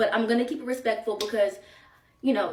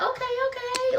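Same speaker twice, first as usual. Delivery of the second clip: very high-pitched, chirpy okay; squeal-like approving reply, kept light; pleased approval; 13 semitones higher than usual. Retold or imitated. Acting out pleased reply to sisters.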